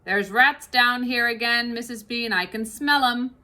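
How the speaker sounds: big kitchen woman grumbling about the fox; Mabel voice. Acted. Mabel sniffing cellar.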